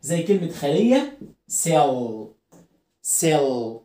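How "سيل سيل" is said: سيل with a heavy, held final l; low سيل ending in "ull"; dark L. Shown as a demonstration. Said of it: "ما ينفعش اقول سيل".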